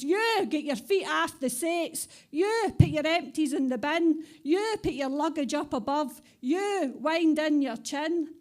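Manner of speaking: stern wee conductor laying down the law; crabbit ticket woman. Acted. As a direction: boss the passengers about like a crabbit conductor. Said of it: "She says".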